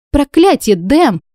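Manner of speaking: high startled exhale; startled gasp. A narrator's reading. Heroine caught in the bath by Dam, startled gasp. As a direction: gasp his name in shock. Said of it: "выдохнула я".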